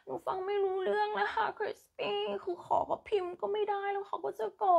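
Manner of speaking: high-pitched pleading complaint; distressed student. Acted. Student begging teacher for help with boyfriend's calls, distressed. Acted plea from a student's message.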